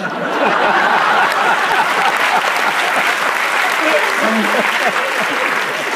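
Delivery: loud real burst of laughter; big laugh. Real, not acted. Storyteller laughing loudly at his own punchline.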